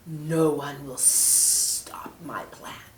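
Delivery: slow, sinister hissed whisper; snake whisper. Acted. Snake taunting the angel in a slow whisper. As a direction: hiss it menacingly.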